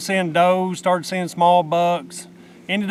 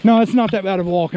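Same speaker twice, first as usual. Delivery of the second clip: louder, fast, pumped-up talk; excited; 2 semitones higher, 5 dB louder, much faster than usual. Real, not acted. Walking to the buck with his partner, fast and pumped. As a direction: rush it out, all jacked up. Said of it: "I'm just ex-, excited".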